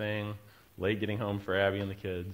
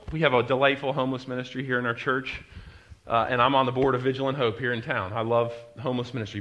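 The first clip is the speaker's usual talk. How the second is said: usual speaking voice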